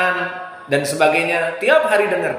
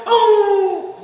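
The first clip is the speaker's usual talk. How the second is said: high mock sobbing gasp into the mic; a touch of a whimper; mock sob; 12 semitones higher than usual. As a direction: imitate a listener breaking down. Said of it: "ada tim yang memang dekat mikrofon".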